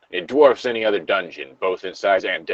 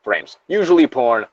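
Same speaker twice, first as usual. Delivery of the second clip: usual speaking voice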